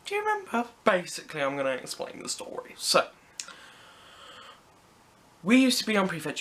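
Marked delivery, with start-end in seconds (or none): 0.1-0.7 squeaky excited question. Real, not acted. Asking school friends Rebecca and Gina, squeaky high. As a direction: leap way up high on remember.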